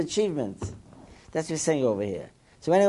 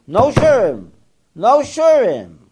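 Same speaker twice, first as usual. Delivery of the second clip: emphatic quoted ruling; 7 semitones higher, 8 dB louder, slower than usual. Retold or imitated. Rabbi's ruling quoted in story.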